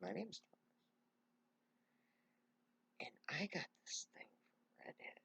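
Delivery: very soft, nearly whispered; hushed reveal. Retold or imitated. Man reveals himself to the stunned woman, hushed near-whisper. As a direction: drop to a whisper for the revelation.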